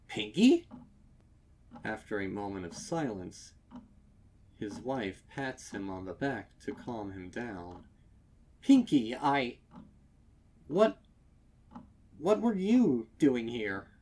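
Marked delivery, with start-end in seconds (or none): 0.1-0.7 high, loud startled yelp. Acted. Husband startled by Pinky in the dark shop, yelping. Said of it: "Mister Cake yelps". 8.6-13.9 loud, high, stumbling startled questions; flustered stammer. Acted. Husband still shaken, asking Pinky why she is there.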